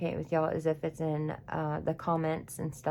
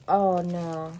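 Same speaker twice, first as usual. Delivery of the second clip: stunned surprise; 4 dB louder, much slower than usual. Real, not acted. Crafter to viewers on finding an unexpected item, stunned surprise. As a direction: blurt it out in real astonishment. Discovers a surprise among items.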